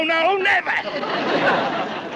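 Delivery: ordinary talk, nothing marked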